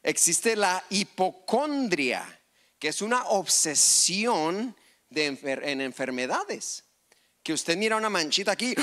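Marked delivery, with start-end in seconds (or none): none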